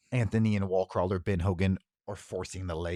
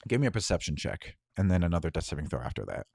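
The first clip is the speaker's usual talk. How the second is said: ordinary talk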